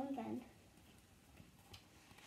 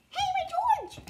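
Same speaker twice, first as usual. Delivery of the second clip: high, loud cry of protest; indignant high-pitched yell; outraged shout; 12 semitones higher, 10 dB louder, much faster than usual. Acted. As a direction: yell for your stolen toy. Toy monkey taken away.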